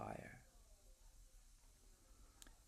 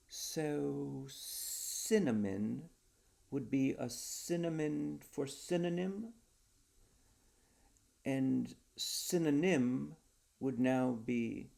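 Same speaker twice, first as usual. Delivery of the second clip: slow pondering; 4 semitones higher, 5 dB louder, slower than usual. A narrator's reading. Johnson working out the wordplay.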